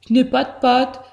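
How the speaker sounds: ordinary delivery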